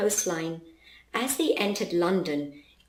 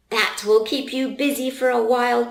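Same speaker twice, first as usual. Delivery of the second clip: a touch louder, lightly yelled; 4 semitones higher, 4 dB louder than usual. Acted. Charlie calling after the fleeing corgis, mildly yelled. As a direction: call it after the dogs, a bit raised. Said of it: "Charlie yelled".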